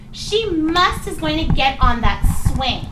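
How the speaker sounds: ramp up the thrill; excited. Shown as a demonstration. Acting out friend's excitement.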